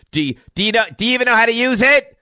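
high, loud exasperated yell; retold yelp from upstairs. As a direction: yelp it out, imitating someone calling from upstairs. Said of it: "this yelp from upstairs".

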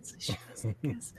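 unvoiced hushed aside; whispering. Real, not acted. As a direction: drop to a whisper. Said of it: "whispered aside".

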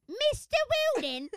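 high, loud mock-Cockney cry; hammed-up Cockney holler; Cockney accent. Defiantly repeating the nickname to co-host, hammed-up Cockney. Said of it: "had to be done in a Cockney accent".

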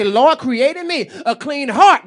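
slow, loud, fervent prayer; loud slow pleading prayer. Acted. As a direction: cry it out to God. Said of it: "I've got to say".

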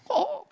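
high-pitched sarcastic "ooh"; mock shocked "oh". Preacher mocking his critic's verdict, mock shock. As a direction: feign scandal with a high "oh".

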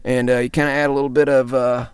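normal talking voice

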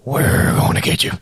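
loud demonic rasp; deep raspy voice. Acted. Ghost taunting a sleeper in a paralysis story, deep and raspy. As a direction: growl it out, a raspy menace from the dark. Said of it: "a deep, raspy voice going".

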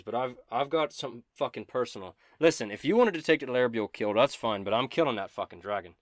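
loud, determined declaration; heated in-character vow; forceful insistence. Acted. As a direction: lay down your claim emphatically. Character insists on killing the dragon.

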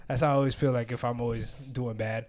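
ordinary delivery

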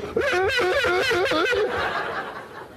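very high, long rising mock scream; phobic shriek. Woman asked about snakes, imitated terrified shriek. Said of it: "And she goes".

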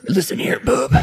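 Wolverine voice. Pretend. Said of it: "Are you Wolverine?".

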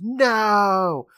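high, drawn-out imitation scream; mock scream. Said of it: "and he screams".

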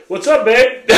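high, laughing cheeky greeting; cheeky through laughter; laughing, casual. Retold or imitated. Drunk husband greeting his wife, laughing. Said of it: "he's like".

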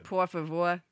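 put-on Boston-accented Spanish; mock Boston accent. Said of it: "a speak Spanish with a Boston accent".

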